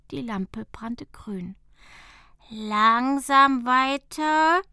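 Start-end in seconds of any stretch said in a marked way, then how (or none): 2.5-4.6 much louder, drawn-out elf voice; slow instruction. Acted. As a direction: tell her to keep turning slowly. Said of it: "sagte Jaro". (Jaro mahnt langsam und laut)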